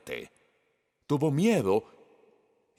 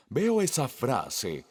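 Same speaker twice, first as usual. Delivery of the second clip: normal talking voice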